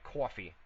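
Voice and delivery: COFFEE with a rounded aw vowel; cawfee, regional aw sound; accented coffee. Shown as a demonstration. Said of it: "say coffee and ass. Your accent is great".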